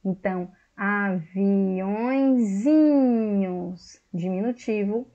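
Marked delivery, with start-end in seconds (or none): 0.8-4.0 aviõezinhos dragged out, a bit louder; slow emphatic demo. Shown as a demonstration. Teacher repeating the new form to pupils, slow and firm. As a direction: lean on aviõezinhos slowly. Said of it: "Eu uso Z".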